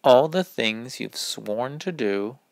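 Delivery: ordinary delivery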